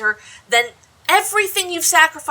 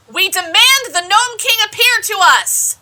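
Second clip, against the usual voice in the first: loud, higher demand to the mountain; commanding shout; 8 semitones higher, 6 dB louder than usual. Acted. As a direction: bellow it at the mountain door. Companion calls out to the king.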